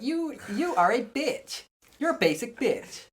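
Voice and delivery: slow, high sassy insult; mock quiz verdict. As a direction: drawl it out like a snarky quiz. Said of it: "hopefully it's like".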